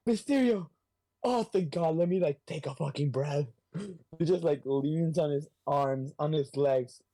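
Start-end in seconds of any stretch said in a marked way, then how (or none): 0.1-3.5 high, mock-relieved cry; relieved exclamation. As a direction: greet the foe with sudden relief, pitched up. Acts Spider-Man relieved to see Mysterio.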